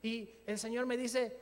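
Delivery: normal talking voice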